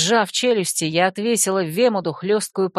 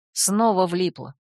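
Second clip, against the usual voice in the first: plain reading voice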